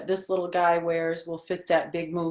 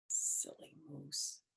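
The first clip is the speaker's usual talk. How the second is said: whisper; 13 dB quieter, slower than usual. Real, not acted. Fond aside about the moose.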